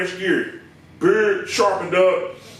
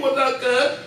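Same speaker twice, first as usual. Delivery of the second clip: higher put-on voice with a laugh; imitated greeting with a laugh; 4 semitones higher than usual. Comic retelling the customer's greeting, laughing. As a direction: repeat the cuz greeting laughing.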